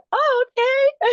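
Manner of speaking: very high, loud retold "oh, okay"; startled acceptance. Mother hearing her son saw her asleep, startled acceptance.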